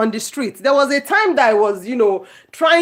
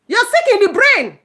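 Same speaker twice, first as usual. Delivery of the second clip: much higher and louder, punching "sick"; shouted insult; 11 semitones higher, 5 dB louder than usual. Real, not acted. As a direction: yell it at the people telling others to leave.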